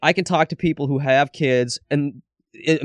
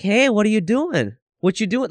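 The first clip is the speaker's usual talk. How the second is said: put-on high sing-song greeting to a small child; high-pitched, emphatic; 8 semitones higher than usual. Shown as a demonstration. Dad demonstrating how he greets his son, high-pitched. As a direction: pitch it up and lean on the words. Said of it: "High-pitched, and you emphasize stuff".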